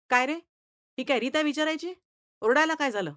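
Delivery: loud, high angry scolding; angry teacher. Acted. Furious teacher scolds the shouting boy. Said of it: "मास्तर चिडले, संतापले".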